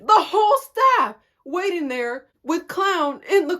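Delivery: loud, high-pitched disbelief; incredulous reveal. Retold or imitated. Player finds all coaches waiting with teammate, incredulous.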